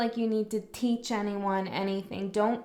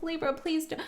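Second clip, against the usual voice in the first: higher, pleading and apologetic; higher, anxious make-nice voice; pleading placation; 8 semitones higher than usual. Retold or imitated. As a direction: beg them not to be hurt, almost gushing. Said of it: "See, I'm, I'm doing it right now with you".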